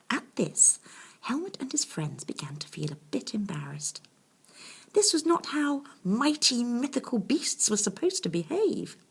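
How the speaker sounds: plain reading voice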